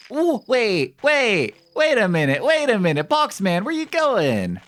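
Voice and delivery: high, loud excited yelling; excited shouting. Real, not acted. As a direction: shriek it out in sudden alarm. Box man suddenly moves.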